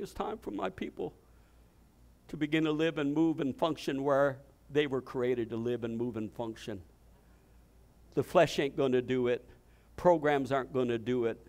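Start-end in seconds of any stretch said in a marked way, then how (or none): 0.0-6.8 soft, low voice of the Lord; hushed prophecy. Retold or imitated. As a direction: deliver the word in a hush. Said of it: "I heard the Lord saying".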